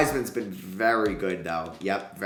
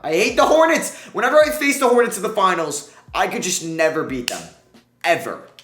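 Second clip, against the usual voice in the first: much higher and louder, fed-up rant; frustrated outburst; 9 semitones higher, 9 dB louder than usual. Real, not acted. Gamer losing to the Hornets again, furious frustrated outburst. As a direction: vent at the team that always beats you.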